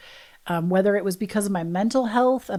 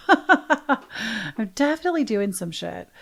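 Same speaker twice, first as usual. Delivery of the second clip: slower, drawn out with a laugh in it; laughing aloud. Real, not acted. Owning it to listeners, laughing and slow. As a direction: savour the admission with a chuckle.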